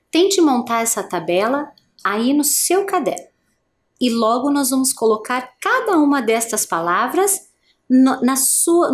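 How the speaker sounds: ordinary delivery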